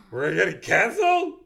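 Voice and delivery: gasp, then high loud alarm; mock shocked outcry. Gasps at season finale news.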